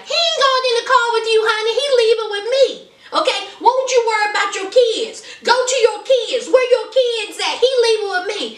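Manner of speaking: high, fast, loud sassy retort; hammed-up smug high voice; mock Maria.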